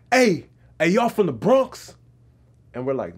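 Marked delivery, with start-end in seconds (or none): 0.1-1.9 loud, high, drawn-out question; imitated street stranger. Stranger asks the camera crew where they're from, hailing loudly. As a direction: mimic an eager stranger hailing you. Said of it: "And then he's like".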